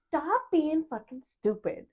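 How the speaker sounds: slow, high-pitched exasperated telling-off; exasperated retort. Retold or imitated. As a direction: drag it out, fed up with the teasing. Exasperated retort at a teasing parent.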